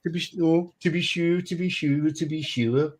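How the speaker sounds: high, lilting stage Irish; mock Irish accent. Joking among friends about accents, mock Irish accent. Puts on stock Irish catchphrase.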